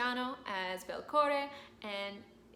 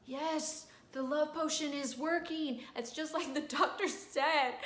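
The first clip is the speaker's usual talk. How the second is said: high, gleeful voice, a bit tipsy; giddy drunk Nemorino; 6 semitones higher, slower than usual. Retold or imitated. Drunk Nemorino thrilled the potion works, high giddy voice. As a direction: crow with delight as the women swarm. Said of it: "he is like".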